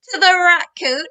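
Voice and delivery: ordinary delivery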